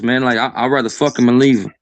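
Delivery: usual speaking voice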